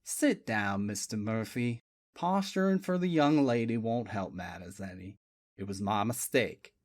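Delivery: a calm, apologetic detective; deep-voiced sheriff type, polite; southern detective. A narrator's reading. Boone's voice.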